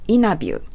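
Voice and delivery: "interview" without its T, like "innerview"; dropped T. Shown as a demonstration. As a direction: skip the T in "interview". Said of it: "インタビューの他を飛ばして発音します". (「interview」のTを飛ばして「イナビュー」と)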